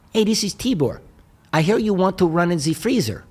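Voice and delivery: imitating an old Eastern European man's accent. Caller from Pasadena to Mike, in a mock Eastern European accent. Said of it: "very heavy Eastern European accent".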